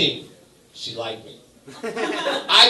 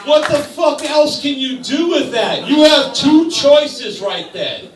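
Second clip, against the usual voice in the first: louder, higher outburst; exasperated shout; 10 semitones higher, 7 dB louder than usual. Real, not acted. Comic exploding at the audience, exasperated shout. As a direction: throw it at the crowd, fed up.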